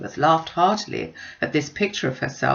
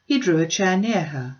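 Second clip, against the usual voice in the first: usual speaking voice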